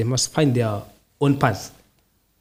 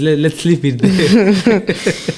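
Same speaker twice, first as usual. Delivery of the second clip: real laughter breaking up the words; higher, slow, stammering through a laugh; 6 semitones higher, 4 dB louder, much slower than usual. Real, not acted. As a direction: laugh it off as you speak. Laughs off going deeper into topic.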